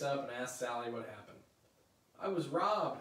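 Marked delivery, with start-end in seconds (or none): 2.2-3.0 higher, louder wail of outrage; howl. Acted. Sally howling at Linus after missing Halloween. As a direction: wail it like you've been cheated. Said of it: "howls Sally".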